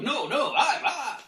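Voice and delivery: loud, high spluttering objection; mock protest. Little block arguing with the other blocks, loud mock protest.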